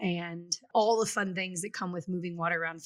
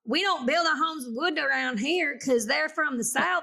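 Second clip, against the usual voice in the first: put-on terrible Southern accent; 5 semitones higher than usual. Aunt explaining Florida homebuilding, exaggerated Southern accent. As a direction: do a bad Southern accent, pitched up. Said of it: "that was a terrible Southern accent".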